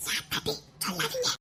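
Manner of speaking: very soft, secretive begging; barely audible, so teacher won't hear; hushed plea. Acted. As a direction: plead under your breath. Pupil secretly begs friends not to tell.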